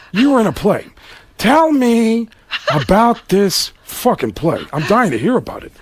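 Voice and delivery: mock-ignorant star, swooping up high; Al Pacino. Pacino feigning ignorance of the play, theatrical sarcasm. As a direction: drag out the words with sarcastic relish. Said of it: "And he goes".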